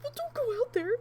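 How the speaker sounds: alarmed warning. Retold or imitated. Retold fear as husband goes out.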